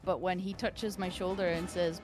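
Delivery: ordinary talk, nothing marked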